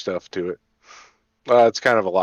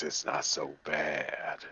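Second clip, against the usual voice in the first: slow, low, soft and sultry; 6 semitones lower, 14 dB quieter, much slower than usual. Shown as a demonstration. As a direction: purr it out seductively. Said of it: "if I talk in a sultry voice".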